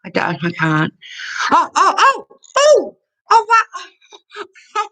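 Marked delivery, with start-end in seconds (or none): 1.5-4.9 high, loud, breaking oh-oh-oh; real overwhelmed joy close to tears; crying out in delight, much higher. Real, not acted. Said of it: "I'm a bit emotional right now".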